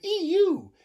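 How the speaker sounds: high loud squealing scream; screaming hog. Acted. The sprayed hog squealing, high and loud. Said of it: "who screamed".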